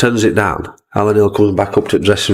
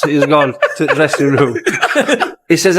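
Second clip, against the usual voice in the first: normal talking voice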